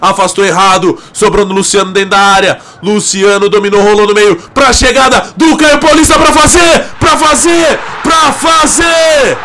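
fast build-up to a shout; explosive goal commentary. Real, not acted. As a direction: race through then yell. Live goal play-by-play.

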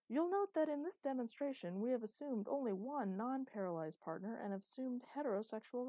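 an eighties training-tape narrator, bright and overeager; hammed-up announcer reading an old rehab video; cheesy video narrator. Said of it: "continued the narrator".

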